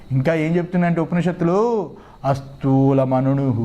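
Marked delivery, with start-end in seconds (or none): 2.2-3.6 low, very slow chant; scriptural intonation. Acted. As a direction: stretch each syllable on a low note. Intones the Upanishad quote. (ఉపనిషత్ పఠనం, చాలా నెమ్మదిగా)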